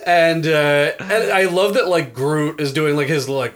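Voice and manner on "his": ordinary talk, nothing marked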